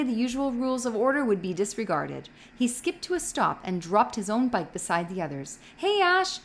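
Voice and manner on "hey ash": loud, high shout of a name; shouted greeting. A narrator's reading. Jonas shouts for Asher in the empty play area, loud and high. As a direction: call out to find your friend. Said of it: "he shouted".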